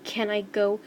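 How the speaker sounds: plain reading voice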